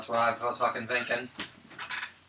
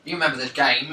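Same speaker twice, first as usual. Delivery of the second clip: ordinary talk, nothing marked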